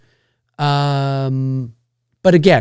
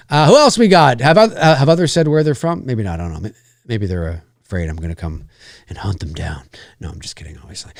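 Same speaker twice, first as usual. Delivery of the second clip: usual speaking voice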